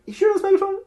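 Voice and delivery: very high, doubting question; sceptical double-check. Acted. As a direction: query it with pitch shooting right up.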